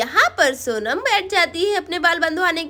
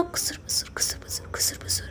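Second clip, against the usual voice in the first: voiceless, very soft whispering; secretive whisper; 12 dB quieter, faster than usual. Pretend. Mother plotting secretly with Radha, whispered. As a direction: whisper nonsense syllables like a secret plot.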